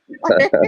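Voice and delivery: short airless laugh; breathy laugh. Real, not acted. Laugh after own story.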